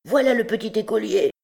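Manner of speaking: high, excited announcement; calling out. A narrator's reading. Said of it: "cria Andry le Rouge".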